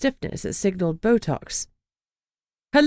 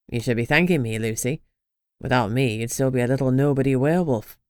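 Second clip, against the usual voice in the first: low, sneering male voice; smug rival; 6 semitones lower than usual. Acted. Rain sneering at Lucy, low and condescending. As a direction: talk down to her like a nobody.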